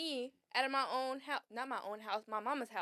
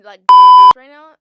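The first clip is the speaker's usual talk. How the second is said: slow, loud, high shout; loud angry yell; 30 dB louder than usual. Retold or imitated. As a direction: blow up at her. Retold confronting friend popping balloons.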